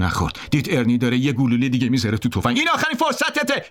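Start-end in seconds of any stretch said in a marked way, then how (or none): 2.6-3.6 high-pitched final threat; shouted warning. A narrator's reading. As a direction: yell a last warning up high. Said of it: "ارنی داد زد".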